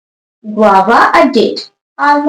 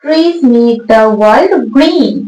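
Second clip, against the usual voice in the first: very slow dictation; much slower than usual. Shown as a demonstration. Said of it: "teacher reads the answer".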